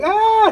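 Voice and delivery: a high, loud short scream; retold scream. Passenger imitating the fallen lady, high loud scream. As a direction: imitate the lady's scream in the aisle.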